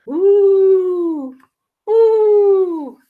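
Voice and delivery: a long high ghostly moaning ooh; spooky monster ooh. Acted. Ziggy imitating the swamp monster for friends, spooky ooh. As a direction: moan like a scary swamp monster. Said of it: "he makes scary sounds".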